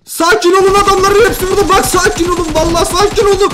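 loud, high-pitched frantic yelling; panicked shouting. Real, not acted. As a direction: yell for calm while losing all calm. Urges calm while panicking at enemies.

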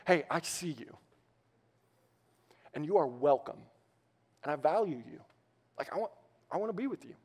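soft, gentle welcome; tender invitation. Pretend. Pastor voicing an invitation to a lonely person, soft and tender. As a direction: say it softly, as if to someone you value.

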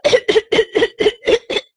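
a sharp hacking cough acted out for the class; imitated choking cough. Doctor acting out the patient's fit for students, mock choking cough. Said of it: "It's like".

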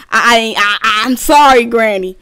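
loud, stammering frantic apology; panicked pleading. Real, not acted. Player apologizing to Granny, panicked stammered pleading. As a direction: stammer out a loud plea for mercy. Begging Granny as she attacks.

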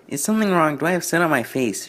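high feminine engine voice. Acted. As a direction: play the new engine as a lady. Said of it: "she asked".

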